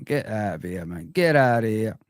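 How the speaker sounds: ordinary talk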